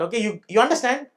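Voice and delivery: usual speaking voice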